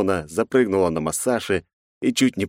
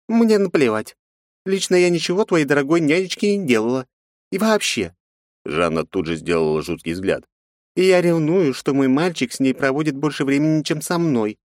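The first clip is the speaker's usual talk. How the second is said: femme fatale; 9 semitones higher than usual. Acted. Жанна капризно ревнует.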